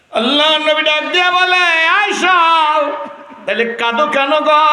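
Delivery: high, loud chanting storytelling; full-force preaching. Acted. Preacher voicing Aisha answering her husband, loud high cry.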